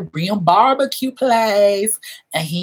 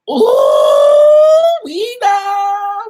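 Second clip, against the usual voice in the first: teasing ooh; 8 dB louder, much slower than usual. Acted. Teasing a friend's story.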